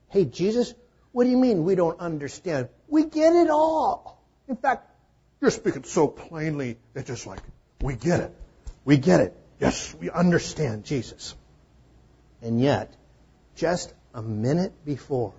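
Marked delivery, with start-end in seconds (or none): 0.1-11.3 high, loud, insistent disciple voice; overeager disciples. Retold or imitated. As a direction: push it up high, as if insisting they get it. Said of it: "what they're saying is".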